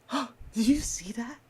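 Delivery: hushed whisper of disbelief; mock whisper. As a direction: whisper it as if to a neighbour. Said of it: "And you're like".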